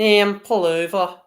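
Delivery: slow Scouse cop voice; Scouse accent. Retold or imitated. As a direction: drawl it like a Scouse policeman. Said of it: "I can't do a Scouse accent".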